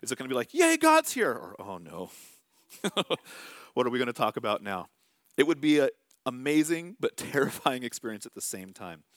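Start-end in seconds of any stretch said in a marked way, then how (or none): 0.5-1.3 much higher and louder, a gleeful squeal; delighted cheer. Pretend. Pastor imagining a happy welcome for God, gleeful cheer. 1.6-4.9 mock dread. Imagined dread at God's visit.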